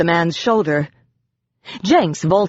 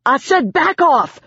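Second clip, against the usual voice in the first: shout; 10 semitones higher than usual. A narrator's reading. Cornered man shouting at the crowd to back off. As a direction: roar it out, spacing the words. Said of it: "Remus shouted".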